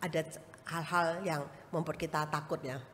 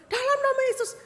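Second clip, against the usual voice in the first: high, loud rebuke in Jesus' name; commanding the snake; 11 dB louder than usual. Retold or imitated. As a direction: order the snake away, loud. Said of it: "Saya langsung".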